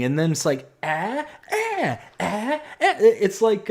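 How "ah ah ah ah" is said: high, thin imitation of a child's repeated screaming; mock toddler screams. Dad imitating his daughter screaming for fun. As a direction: ham up a toddler shrieking. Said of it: "So then she starts screaming".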